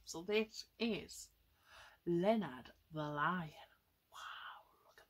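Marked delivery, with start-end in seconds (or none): 4.1-5.1 awed whisper. Acted. Awed at the lion.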